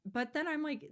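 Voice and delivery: ordinary delivery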